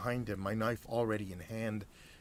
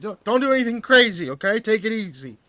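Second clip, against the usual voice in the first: high, loud, frightened pleading; panicked hostage begging at knifepoint; quavering plea; 12 semitones higher, 15 dB louder than usual. Acted. Hostage begging the narrator, high panicked plea. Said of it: "He quavered".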